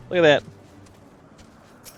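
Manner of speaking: ordinary talk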